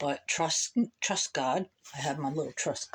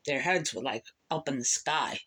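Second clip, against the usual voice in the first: ordinary talk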